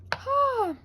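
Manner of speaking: sudden high loud yelp; a shocked cry at the mistake; real startled cry. Real, not acted. Paint mishap.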